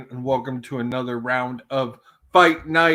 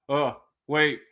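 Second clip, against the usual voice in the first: ordinary talk, nothing marked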